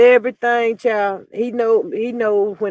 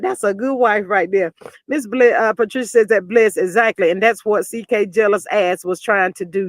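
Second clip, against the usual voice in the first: ordinary delivery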